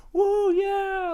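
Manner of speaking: very high, loud whoop like rowdy fans at a fight; imitating cheering fans. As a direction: mimic the crowd hollering at the screen.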